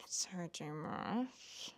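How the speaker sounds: slow, hushed whisper of the name; slow disgusted whisper. Real, not acted. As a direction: breathe the author's name out in disgust. Naming an author she dislikes.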